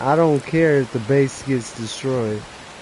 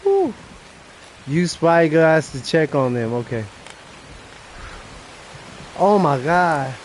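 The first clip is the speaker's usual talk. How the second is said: ordinary talk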